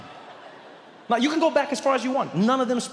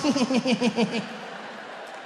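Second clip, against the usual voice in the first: chuckly Bush laugh; 2 semitones higher, 3 dB quieter than usual. Retold or imitated. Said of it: "that weird chuckly thing".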